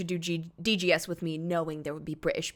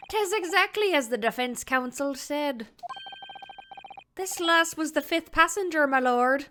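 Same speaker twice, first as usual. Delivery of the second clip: animated Irish storytelling, begorra; Irish accent; 9 semitones higher than usual. Acted. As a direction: tell it with Irish gusto. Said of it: "continues the Irish voice".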